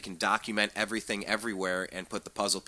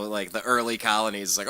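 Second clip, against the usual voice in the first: ordinary delivery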